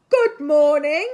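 very loud trumpeted greeting; trumpeting elephant. A narrator's reading. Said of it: "the elephants would trumpet".

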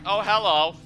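imitating German kid. Player mocking their own running out, imitating German kid. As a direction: lightly play a kid popping out to say hello. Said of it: "like the freaking German kid".

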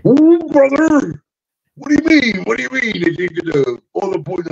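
worried Macho asking; high alarmed Macho voice; Macho Man impression. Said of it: "Macho replying".

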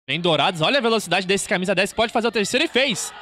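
from eager call to shouting; building excitement. Real, not acted. Attack building to goal.

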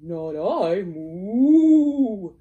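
loud mooing cow; lazy cow refusing. Acted. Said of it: "said the cow".

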